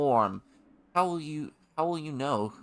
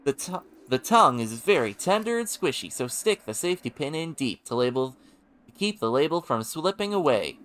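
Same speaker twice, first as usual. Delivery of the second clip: louder, higher TV pitchman; Billy Mays impression; 4 semitones higher, 6 dB louder than usual. Shown as a demonstration. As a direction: pitch it like an infomercial host. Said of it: "I need to Billy Mays impression the thing".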